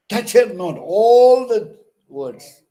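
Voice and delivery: Touch her not with all words hammered high and loud; every word stressed. Shown as a demonstration. Teacher showing all words stressed, loud and high. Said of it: "stressed there".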